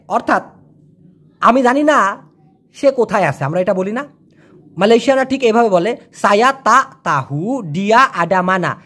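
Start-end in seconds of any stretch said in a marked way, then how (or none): 6.1-8.8 slow "সায়া তা তাহু ডিয়া আডামানা", every a full; clear pronunciation. Shown as a demonstration. Teacher showing the clear sentence, slow careful pronunciation. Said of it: "এটা হলো একদম clear".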